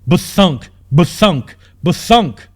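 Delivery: the giant is right there; looming thuds. A narrator's reading. Giant footsteps near.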